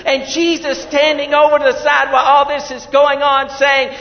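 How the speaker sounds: loud, high sermon voice; fiery preaching. Acted. Preacher describing the water ceremony, fiery preaching. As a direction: drive the story home to the pews.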